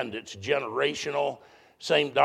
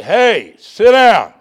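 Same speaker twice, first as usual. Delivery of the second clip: very loud, high yell from the pulpit; sudden booming demonstration of a reprimand; preacher's scolding shout; 8 semitones higher, 17 dB louder, much slower than usual. Acted. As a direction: call the congregation sharply to order. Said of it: "for the preacher from the pulpit to say".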